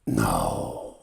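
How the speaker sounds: very low, faint croak of a dying servant; dying man's rasp. Acted. Dying servant rasps at the young hero. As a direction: breathe it out like a man near death. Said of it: "whispers the man".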